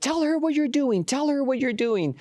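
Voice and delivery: high, insistent retold demand; imitating frantic woman. Lady pushing student to tell her friend, frantic imitation.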